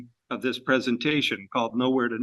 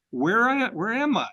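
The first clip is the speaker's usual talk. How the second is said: bewildered pilot; 8 semitones higher than usual. Retold or imitated. Lost mail pilot asking the young farmer, high and bewildered. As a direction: play the lost airmail pilot, thrown and confused.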